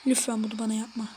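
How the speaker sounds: ordinary delivery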